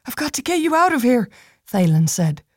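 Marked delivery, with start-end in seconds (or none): 0.1-1.3 urgent, fast. Acted. Urgent line, fast and high.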